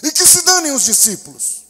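loud, high, frantic plea; a parent begging for help for a daughter, shouting; desperate cry for help. Acted. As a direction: cry out for your child's life. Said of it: "você iria gritar".

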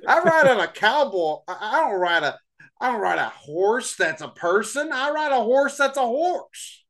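high goofy country voice; silly cowpoke. Pretend. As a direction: play a dopey ranch hand. Cowpoke bit continues.

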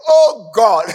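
loud, high outcry through laughter; laughing mock wail; cry to God. Moses crying out to God.

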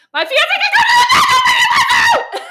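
high, loud, slow mock shout with a laugh; mad yell. Phoebe snapping at Monica about her fiance, laughing mock shout. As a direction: blow up on the last words. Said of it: "she gets mad".

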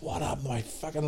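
quiet bewildered grumble; half-muttered confusion; puzzled, muted. Retold or imitated. Sore leg next morning.

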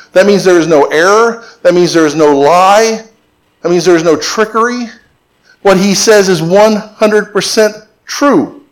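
usual speaking voice

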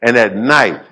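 ordinary talk

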